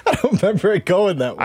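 high, laughing through the words. Real, not acted. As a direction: laugh while repeating the line. Echoing the story while laughing.

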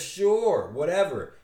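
bored shrug of a reply; dismissive. Acted. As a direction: brush it off. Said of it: "Impulse says".

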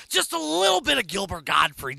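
Gilbert Gottfried impression. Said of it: "break into a little Gilbert Godfrey".

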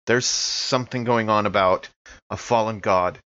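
normal talking voice

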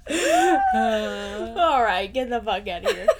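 loud and laughing. Real, not acted. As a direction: shoo them off while cracking up. Laughing mock dismissal.

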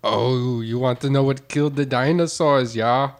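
put-on muddled Jamaican-Austrian accent; Schwarzenegger-like Jamaican accent. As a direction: fake Jamaican with heavy Arnold vowels. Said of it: "it's like Arnold Schwarzenegger now".

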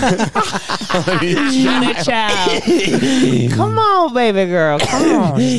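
high, drawn-out sweet-talk; hammed-up wheedling; comic cajoling. Defendant sweet-talking jury, acted.

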